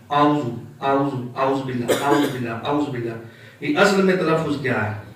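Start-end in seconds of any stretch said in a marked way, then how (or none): 0.1-3.1 اعوذ said as "aus", ain dropped; wrong pronunciation. Shown as a demonstration. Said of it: "یہ ہی تلفظ بن جاتا ہے". (اعوذ میں عین غائب)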